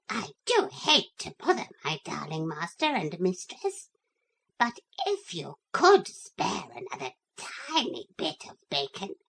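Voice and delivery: coaxing sweet pet begging; begging little dog. Acted. Brenda begging bacon.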